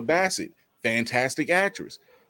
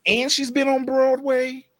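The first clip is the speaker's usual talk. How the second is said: high-pitched, escalating disbelief; mock astonishment; 10 semitones higher than usual. Grown-up fan learning she was passed over, incredulous. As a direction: pile up the outrage, each point higher.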